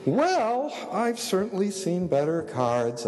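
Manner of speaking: hammed-up man playing a woman; bagpipe-like mock drag queen warble. A narrator's reading. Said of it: "in a sort of guy imitating a woman, Monty Python, bagpipe, drag queen voice".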